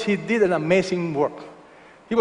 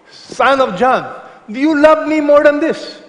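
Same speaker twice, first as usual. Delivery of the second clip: higher, louder, searching question from Jesus; voicing Jesus; 8 semitones higher, 7 dB louder than usual. Jesus pressing Peter, asked higher and louder. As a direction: call out the question as Jesus would ask it.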